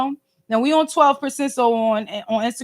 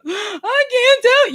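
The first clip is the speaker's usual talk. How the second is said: high-pitched, teary imitation of herself; mock crying; 10 semitones higher, slower than usual. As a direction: bawl it out, much higher, like a baffled crybaby. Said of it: "And I'm like".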